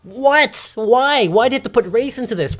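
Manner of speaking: high, loud what and why; baffled outrage at the teacher; incredulous protest, pitched high; outraged disbelief. Real, not acted.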